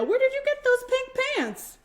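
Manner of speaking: teacher startled by the pink pants, high; surprised teacher. Acted. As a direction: ask it in disbelief.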